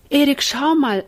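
high, loud call to the other room. Acted. Sister calls her brother over to see, loud call.

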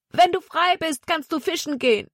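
put-on high boyish voice calling after a friend; wistful call to a friend running away; Jonathan calling after. A narrator's reading. Jonathan calls after escaping Zack, high boyish call. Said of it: "schrie Zack immer noch hinterher".

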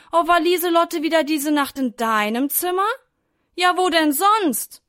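high, loud sarcastic retort; pointed, exclaiming storybook quarrel; indignant bickering. A narrator's reading. Acted sibling bickering over the mosquito.

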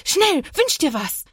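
put-on high, urgent child's cry; thrilled, high-pitched exclamation with stretched words; excited call. A narrator's reading. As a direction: urge a friend on, voice leaping up. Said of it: "ruft Lana aufgeregt".

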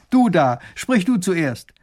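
high, loud, drawn-out order; commanding shout, slow and high; calling out. A narrator's reading. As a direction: call down to someone in a crowd. Ruler calling to man in crowd.

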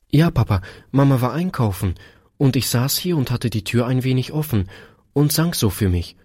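put-on high, lively little-girl storytelling; eager little daughter. A narrator's reading. Daughter telling Papa about the kind lady, eager high voice.

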